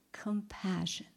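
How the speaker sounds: soft, near-whispered word; hushed appeal. Acted. God questions Jonah about compassion, hushed. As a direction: drop to a breath of a plea.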